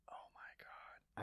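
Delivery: breathy whisper, slow; soft slow whispered oh my God; whispered aside. Real, not acted. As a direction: whisper it to yourself in dismay. Realization, whispered.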